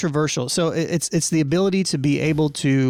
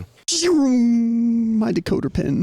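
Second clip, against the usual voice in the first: put-on old-timey radio voice; 8 semitones higher, much slower than usual. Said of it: "the Dick Tracy little decoder pen".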